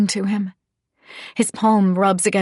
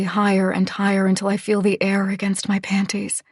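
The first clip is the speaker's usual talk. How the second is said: ordinary delivery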